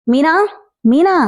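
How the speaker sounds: mild acted shout for someone, rising at the end; calling out sharply. Husband calls for his wife from across the house, mildly shouted. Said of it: "ఎందుకలా అరుస్తున్నారు".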